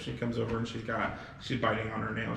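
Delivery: ordinary talk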